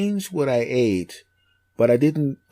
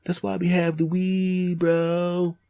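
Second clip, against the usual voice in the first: higher, soft stoner bro voice; laid-back Californian; 5 semitones higher, 5 dB quieter, slower than usual. Acted. Stoner local explaining the laid-back life, drawling. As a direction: stretch it out like a mellow California stoner. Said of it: "we're laid back here".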